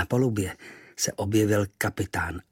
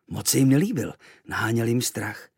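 ordinary delivery

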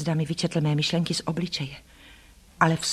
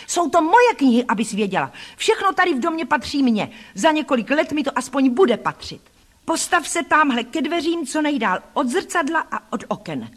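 high, possessive bully's outburst; raised, indignant boy's voice; bossy anger; 6 semitones higher than usual. A narrator's reading. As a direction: order the cousin about imperiously. Bully claims books, orders her away.

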